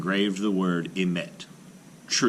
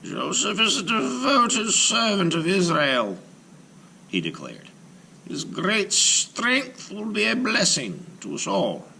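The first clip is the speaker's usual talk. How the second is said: declaring rabbi. Acted. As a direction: reassure the frightened people. Rabbi declares to his people.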